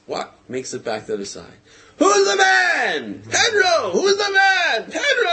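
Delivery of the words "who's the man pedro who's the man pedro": very high, loud mock cheering; retold stadium chant, pitched way up; screamed call-and-response. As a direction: imitate hero and crowd hollering back and forth. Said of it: "the crowd's going crazy".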